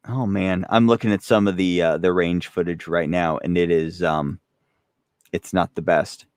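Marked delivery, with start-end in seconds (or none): none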